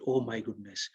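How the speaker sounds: low, hushed retold exclamation; shell-shocked. Passenger learning of the mother's death, shell-shocked and hushed. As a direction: say it under the breath, stunned. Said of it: "shell-shocked".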